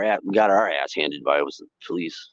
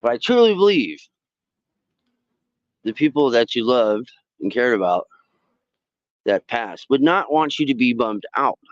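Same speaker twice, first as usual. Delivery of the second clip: ordinary talk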